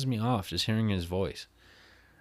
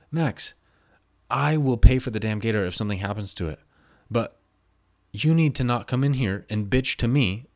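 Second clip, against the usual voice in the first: loud, pitched-up angry rant; angry telling-off; 3 semitones higher, 6 dB louder than usual. Retold or imitated. To Max who complained about his gator, loud angry rant. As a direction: let him have it, voice raised. Retold heated argument with coworker.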